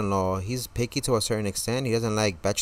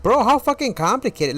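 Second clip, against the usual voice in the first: high, loud retold exasperation; exasperated outburst; 13 semitones higher, 7 dB louder than usual. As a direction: blow up in disbelief, pitched way up. Retelling exasperation at confused waiter.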